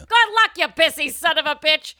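high, loud sign-off; loud, high mock insult; hammy shout. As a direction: yell a mock-insulting farewell.

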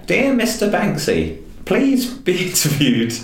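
high, singsong begging in jest; mock pleading. Mock plea to Banksy.

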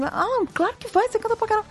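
high, eager reassurance to a nervous son; mother lifts her voice to cheer him on; reassuring mother. Retold or imitated. Mother reassuring her shy son before the audition, high and eager. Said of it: "Eu falei assim".

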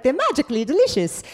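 quick Lucky Charms Irish lilt; Irish accent. Retold or imitated. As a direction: rattle it off like the cereal ad leprechaun. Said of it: "when I need an Irish accent".

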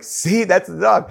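high, drawn-out told-you-so; vindicated child pleading his case; excited insisting kid. Retold or imitated. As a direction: insist in a high voice, stretching the words. Child proving he heard a dog.

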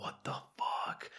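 slow, breathless whispered disbelief; stunned whisper. Retold or imitated. Staring back at wide-eyed classmates, stunned slow whisper. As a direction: mouth it in shock, slowly.

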